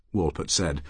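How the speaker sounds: ordinary delivery, nothing marked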